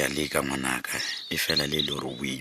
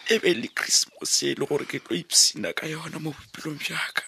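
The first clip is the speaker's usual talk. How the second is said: wailing grief. Acted. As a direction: cry out in despair, voice breaking. Father wailing over son's death.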